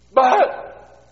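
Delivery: high, loud yelped objection; protesting boy. Acted. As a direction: blurt a panicked kid's objection. Boy protests being spanked.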